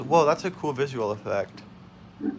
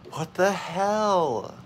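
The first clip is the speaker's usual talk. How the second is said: baffled; 8 semitones higher, much slower than usual. Real, not acted. Dismayed at game event.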